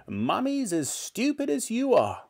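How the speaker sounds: put-on high, nasty old-woman voice; scornful grandmother. A narrator's reading. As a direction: insult him as a spiteful granny. Grandmother insulting George.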